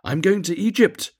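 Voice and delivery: high, loud happy announcement; excited cry. A narrator's reading. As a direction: call it out for everyone to hear. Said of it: "cried the swallow".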